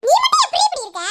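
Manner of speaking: loud, high-pitched reproach; exasperated outburst. Acted. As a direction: burst out at your friend in disbelief. Exasperated with carefree friend. (எரிச்சலில் வெடித்துக் கேட்டல்)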